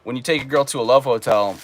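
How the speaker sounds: ordinary talk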